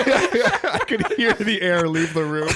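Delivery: slow, high words squeezed through laughter; helpless laughter. Real, not acted. Host laughing at his own flopped joke, helpless laughter. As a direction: crack up as you speak.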